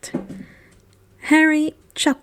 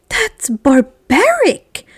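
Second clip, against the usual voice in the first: indignant growl; 4 semitones higher, much slower than usual. A narrator's reading. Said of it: "Hermione growled in indignation".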